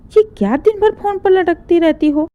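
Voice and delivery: mocking mother-in-law. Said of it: "मुझसे कहती हैं".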